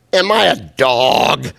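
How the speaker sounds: slow, loud mock roar; roaring giant. A narrator's reading. Preacher voicing Goliath taunting David, slow mock roar. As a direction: bellow it like a scornful giant. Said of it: "he roared at David".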